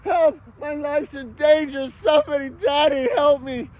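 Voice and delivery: mock pleading ex. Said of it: "So she lies to her family".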